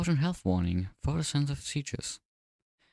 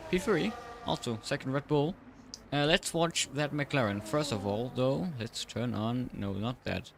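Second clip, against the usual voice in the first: race commentary; 2 semitones higher than usual. Acted. Live race play-by-play.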